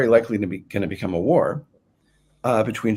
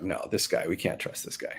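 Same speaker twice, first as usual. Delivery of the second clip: hushed; 8 dB quieter than usual. Retold or imitated. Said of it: "they're like".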